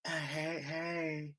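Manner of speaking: soft drowsy phone greeting; mock half-asleep murmur; sleepy voice. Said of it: "sleepy voice is the sexy voice".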